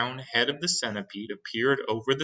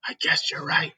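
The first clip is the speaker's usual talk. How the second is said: whisper. Acted. Fire chief answers.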